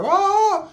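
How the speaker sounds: high mock cry, drawn out; imitated yelp. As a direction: mimic a high kung fu wail. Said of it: "He was like".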